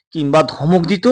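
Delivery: ordinary delivery, nothing marked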